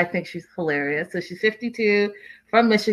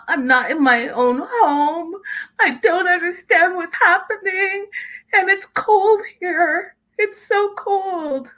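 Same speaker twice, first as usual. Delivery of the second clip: much higher, slow whimpering voice; whiny high mock wife; 11 semitones higher, slower than usual. Vlogger imitating a sulking wife, high and whimpering. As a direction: play her as a lost, pitiful child. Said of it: "And she's like".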